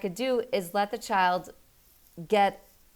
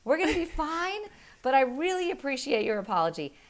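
kind but firm; 9 semitones higher than usual. Retold or imitated. Mother telling husband the punishment stands, kind and firm. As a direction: hold the line gently. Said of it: "And I said".

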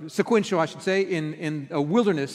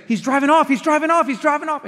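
higher, louder urgent repeat; frantic daughter; 8 semitones higher, 4 dB louder than usual. Retold or imitated. Daughter on the phone after the crash, alarmed. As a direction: relay her panic, raised and hurried. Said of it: "I hear her say".

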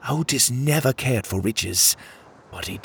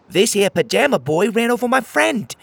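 a loud, much higher indignant crab voice; angry crab; 12 semitones higher, 5 dB louder than usual. Acted. Crab friend accusing Pockets before the others, angry. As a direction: accuse him, furious on your friend's behalf. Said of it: "said the angry crab".

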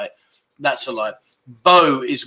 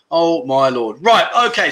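ordinary talk, nothing marked

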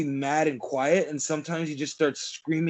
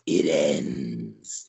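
very deep, demonic growl; demonic exorcist voice. Retold or imitated. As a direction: drop into a possessed demon voice. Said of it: "channel his inner exorcist".

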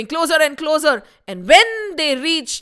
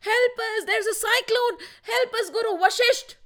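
cries for help; 10 semitones higher, 5 dB quieter than usual. Acted. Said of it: "They started shouting".

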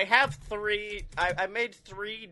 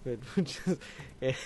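ordinary talk

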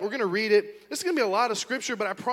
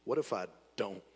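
low, flat challenge, much lower; menacing dare; 12 semitones lower, 6 dB quieter than usual. Retold or imitated. Angry customer challenges his mom, low menacing dare. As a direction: drop the voice low and dare her. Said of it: "Just like that".

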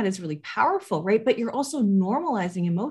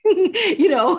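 laughing; 10 semitones higher, 7 dB louder than usual. Real, not acted. Laughing while describing grouchy caregiver.

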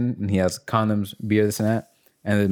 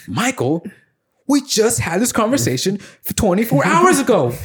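higher, slow, pointed reminder; mock stern host; 11 semitones higher, 4 dB louder, slower than usual. Host confronting the man, mock slow and higher. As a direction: lay out the facts slowly.

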